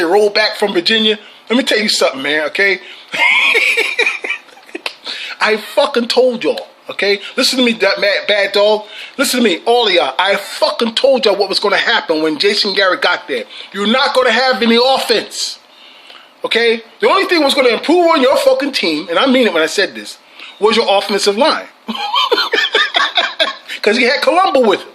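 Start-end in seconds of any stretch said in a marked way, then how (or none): 3.1-4.4 high cackling laugh. Real, not acted. Bursts out laughing mid-rant. 21.9-23.8 high cackling laugh. Real, not acted. Laughs at his own jab.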